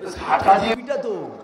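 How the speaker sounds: loud, high punchline yell; mock angry shout. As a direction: bark it like the angry wife, hammed up. Mock angry wife reaction.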